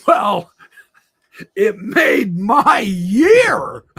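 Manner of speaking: loud, slow, laughing; laughing shout. Real, not acted. To the audience, a loud laughing outburst. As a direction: belt it out through laughter.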